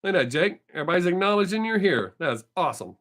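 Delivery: ordinary talk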